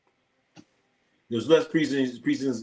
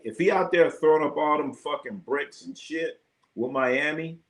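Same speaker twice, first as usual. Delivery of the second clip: ordinary delivery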